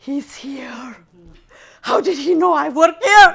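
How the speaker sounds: startled panic. Retold or imitated. Panic seeing the husband.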